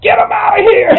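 very loud, high shouted order; full yell. Retold or imitated. Bank president throws the photographers out, furious yell. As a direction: bellow it so the whole bank echoes. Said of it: "he yelled".

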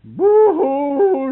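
high, loud hammed-up boo-hoo; mock bawling. Daughter bawling after her father says no. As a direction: wail it like a spoiled kid. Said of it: "she bellered you could hear her".

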